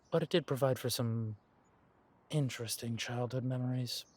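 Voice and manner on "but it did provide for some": normal talking voice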